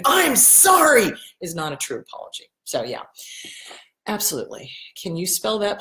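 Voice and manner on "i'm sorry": loud, high, drawn-out shouted sorry; screamed sorry. Shown as a demonstration. Showing a fake apology, a loud slow shout. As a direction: yell the apology, slow and far higher. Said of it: "a screamed".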